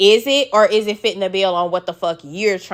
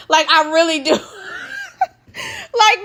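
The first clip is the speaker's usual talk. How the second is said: high, helpless laughing; real laughter; 11 semitones higher, slower than usual. Real, not acted. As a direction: crack up while trying to talk. Cracking up at own impression.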